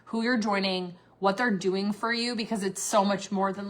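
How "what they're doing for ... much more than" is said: normal talking voice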